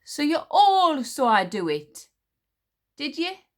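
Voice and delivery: queen daring them to agree, menacing. A narrator's reading. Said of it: "eyes narrowed to splinters".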